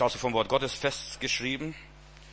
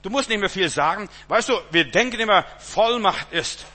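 preaching emphasis; 6 semitones higher, 6 dB louder than usual. Real, not acted. Preacher rising to a climax.

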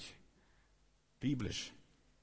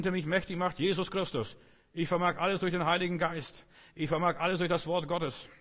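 louder and higher, triumphant proclamation; bold declaration; 5 semitones higher, 4 dB louder than usual. Shown as a demonstration. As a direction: proclaim it with full faith. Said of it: "Komm raus aus dieser Enge und sag". (laute, siegesgewisse Proklamation)

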